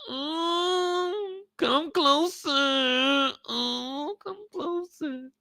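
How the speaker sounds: very high, breathy seduction; seductive drug voice. Acted. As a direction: coax him closer, high and slow.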